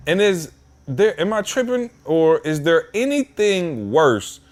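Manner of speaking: high, drawn-out exasperated question; exasperated rant. Real, not acted. Venting to the podcast hosts about the DMV, exasperated and high. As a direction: stretch it out in disbelief.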